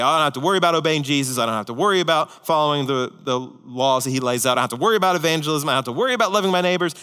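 fast, breezy mock list of excuses; careless believer rattling off. Preacher mocks believers dodging obedience, fast and breezy. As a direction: rattle it off like a lazy believer shrugging duty. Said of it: "the rest he gives isn't a".

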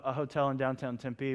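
normal talking voice

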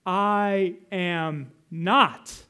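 "I am not" word by word, very slowly; drawn-out "I am ... not", landing hard on "not"; emphatic I AM, NOT. Shown as a demonstration. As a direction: stretch "I", "am" and "not", each standing alone. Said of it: "But notice it here".

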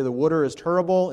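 Northeast US accent on "water" and "terrible"; imitated Northeast regional pronunciation. Shown as a demonstration. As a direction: say "water" as "wooter" and "terrible" as "turrible", Northeast style. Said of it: "we can tell someone's from the Northeast".

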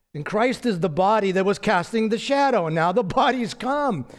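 usual speaking voice

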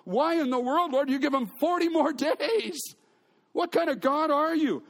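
high, pleading outrage at God; mock-indignant Jonah complaining to the Lord; indignant protest. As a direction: demand an answer from God.

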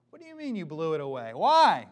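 loud, fast protesting question; mock outrage of the people; indignant people. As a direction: demand an answer, incredulous.

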